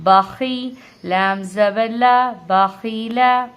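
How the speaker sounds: loud, slow syllable-by-syllable reading; model spelling. Shown as a demonstration. Teacher repeats the word after explaining kha, slow loud drill. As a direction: join the syllables in a measured chant. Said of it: "ہم خا زیر خی پڑھ رہے ہیں".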